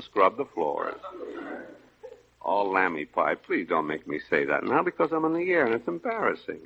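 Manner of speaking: the tough guy shrinks to a timid whisper of a husband; meek henpecked husband. Acted.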